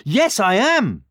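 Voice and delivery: high, loud boastful reply; perky high-pitched character voice; gingerbread man. A narrator's reading. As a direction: answer proudly in a high little voice. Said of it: "said the gingerbread man".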